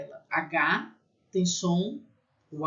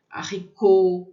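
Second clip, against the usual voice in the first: Haricot, loud, weight on the final co; strong final syllable; 7 dB louder than usual. Shown as a demonstration. Teacher demonstrates French end stress, strong final syllable. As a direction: punch the end of Haricot, silent H. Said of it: "é forte no final".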